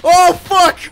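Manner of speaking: loud, high warning yell; alarmed shout. Real, not acted. Spots German checkpoint, alarmed.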